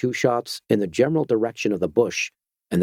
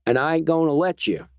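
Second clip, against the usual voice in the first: higher Georgia-twanged vigilante, sly and unhurried; a lightly voiced Georgia backwoodsman toying with the stranger; 6 semitones higher than usual. A narrator's reading. Vigilante warns the doctor off in a Georgia twang. Georgia man holds his voice.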